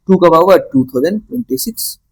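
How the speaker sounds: usual speaking voice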